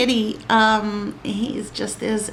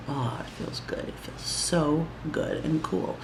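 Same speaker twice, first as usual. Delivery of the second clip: breathy whisper of pleasure; 9 dB quieter than usual. Real, not acted. Whispering to viewers about the cooling tip. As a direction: whisper how good it feels. Feeling the cool product on skin.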